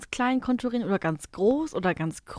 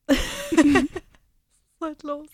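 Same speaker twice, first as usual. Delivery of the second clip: slow words through laughter; laughing; 5 semitones higher, 3 dB louder, much slower than usual. Real, not acted. Laughing after awkward moment.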